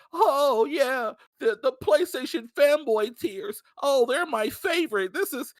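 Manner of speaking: high, drawn-out gloating mock voice; mocking imitation. Imitating a rival celebrating layoffs, high mocking voice. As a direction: mimic a man relishing fanboy tears. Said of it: "He comes by".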